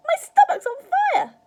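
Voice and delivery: very high, full-volume screech; shouted. A narrator's reading. Grandma crying that she burns inside, shouting. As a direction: shriek it in burning alarm. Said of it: "she shouted suddenly".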